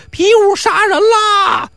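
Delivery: high, slow terrified scream; panicked scream. Acted. Crowd flees Pi Wu's knife, shrieking in terror. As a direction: shriek as if fleeing for your life.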